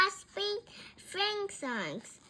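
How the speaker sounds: usual speaking voice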